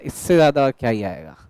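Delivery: mock student voice. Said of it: "Arihant कह रहा है".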